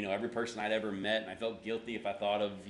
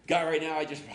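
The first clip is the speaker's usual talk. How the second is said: loud, higher, strained prayer that jams; stuck in prayer; 6 semitones higher, 8 dB louder than usual. Shown as a demonstration. Pastor illustrating his failed prayer, words getting stuck. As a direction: start praying forcefully, then choke off. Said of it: "to kinda illustrate it to you".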